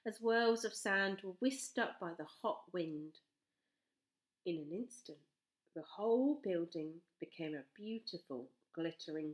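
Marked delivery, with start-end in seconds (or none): none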